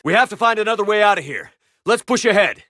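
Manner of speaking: urgent commander yell; urgent shout. Acted. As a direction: hurry the squad onward. Urgent combat orders.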